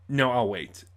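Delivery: ordinary delivery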